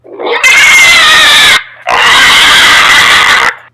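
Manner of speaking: big burst of high laughter; loud laugh. Real, not acted. GM laughs at fleeing owlbear.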